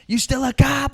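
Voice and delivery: high, loud mock demand; hammed-up shouted question; imitated interrogation. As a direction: fire it out like a heated interrogator. Retelling violent interrogation from film.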